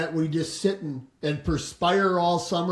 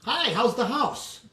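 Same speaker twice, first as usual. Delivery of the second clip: higher, put-on friendly hello to a stranger; bright mock greeting; 7 semitones higher than usual. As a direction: fake a cheery hello. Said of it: "turn around and say".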